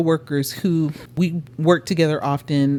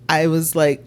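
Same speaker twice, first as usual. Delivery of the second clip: normal talking voice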